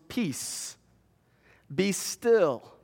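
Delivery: hushed, steady command; calm command. A narrator's reading. Preacher voicing Jesus calming the wind, hushed. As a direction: still the storm gently.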